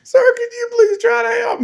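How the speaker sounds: very high, wailing plea for help; mock desperate plea. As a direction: imitate a hurt boy begging. Acts boy begging dad.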